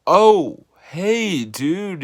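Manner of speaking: sudden high, loud oh hey of realizing who it is; surprised recognition. Retold or imitated. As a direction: it dawns on you mid-word. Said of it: "And I'm like".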